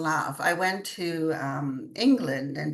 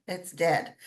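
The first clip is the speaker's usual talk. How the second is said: usual speaking voice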